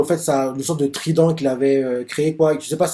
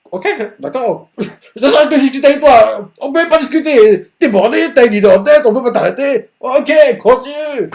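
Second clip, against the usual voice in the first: mock exasperation; 10 semitones higher, 7 dB louder than usual. Mocking reply to stubborn Domina.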